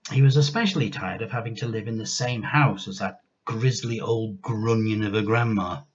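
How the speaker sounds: ordinary delivery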